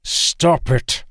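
high-pitched outburst; sharp protest. A narrator's reading. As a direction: cut in with a sudden, high protest. Acted quarrel, sharp protest.

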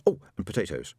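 plain reading voice

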